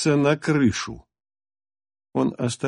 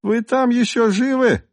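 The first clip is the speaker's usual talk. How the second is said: much higher, squeaky and thin; 11 semitones higher than usual. A narrator's reading. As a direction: squeak it like a weedy thug. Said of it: "писклявым голоском крикнул Клетчатый".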